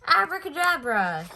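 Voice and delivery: loud, high magic incantation; magic word. A narrator's reading. Bear cub proclaiming his magic word to the guests. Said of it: "he said".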